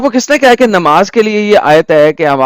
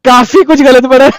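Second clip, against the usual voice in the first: higher voice breaking into laughter; laughing; 5 semitones higher than usual. Real, not acted. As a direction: laugh at the botched recitation. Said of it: "भाई साहब हंस रहे हैं". (हँसते हुए बोलना)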